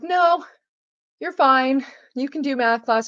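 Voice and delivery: high-pitched mock reassurance; high, breezy retold brush-off; mock dismissive. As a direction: imitate a teacher brushing off an injury. Retelling teachers' dismissive brush-off.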